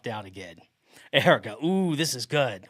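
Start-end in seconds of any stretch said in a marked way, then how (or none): none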